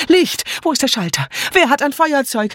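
high, panicky cries in the dark; confused calling out. A narrator's reading. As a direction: call for light in a high fluster. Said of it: "alle riefen wirr durcheinander".

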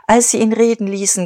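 plain reading voice

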